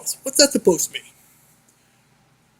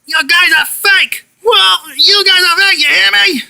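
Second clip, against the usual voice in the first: angry yelling; 6 semitones higher, 4 dB louder than usual. Acted. Yelling accusation.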